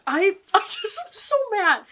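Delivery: high, loud laughing outburst; half-laugh, half-rage at the book; laughing mad. Real, not acted.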